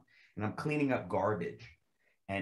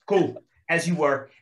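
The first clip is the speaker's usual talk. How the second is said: sergeant dismissal; 5 semitones higher, 7 dB louder than usual. Retold or imitated. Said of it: "he goes".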